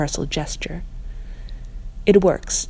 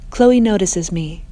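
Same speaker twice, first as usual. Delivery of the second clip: ordinary delivery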